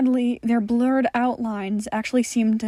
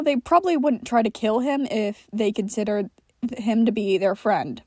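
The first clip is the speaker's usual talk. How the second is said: usual speaking voice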